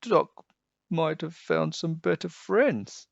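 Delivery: a touch of sad little cat voice. A narrator's reading. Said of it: "whispered in a sad little voice".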